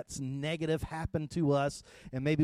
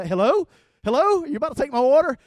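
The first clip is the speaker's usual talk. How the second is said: calling out; 8 semitones higher, 9 dB louder, faster than usual. Retold or imitated. Calling into drive-thru speaker.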